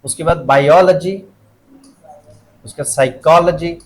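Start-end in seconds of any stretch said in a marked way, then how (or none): 0.4-1.2 "biology" as bai-OL-uh-jee; stress demo. Shown as a demonstration. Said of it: "लजी के पहले क्या आ रहा है?". 2.8-3.7 "psychology" as sai-KOL-uh-jee; stress demo. Shown as a demonstration. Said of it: "को आ रहा है".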